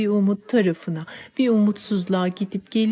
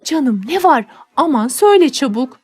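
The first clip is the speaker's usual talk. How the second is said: anxious urging; 5 semitones higher, 6 dB louder than usual. Acted. Nanny urges anxiously.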